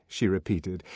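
usual speaking voice

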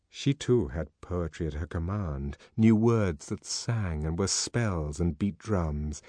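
usual speaking voice

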